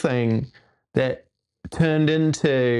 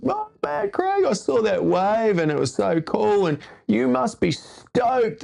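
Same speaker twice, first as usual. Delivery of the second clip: high, excited mate voice, much higher; imitating amped friend; 9 semitones higher than usual. Friend Ben raving about the wave, high and excited. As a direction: gush like a stoked surf buddy. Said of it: "He was like".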